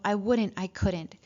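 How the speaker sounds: normal talking voice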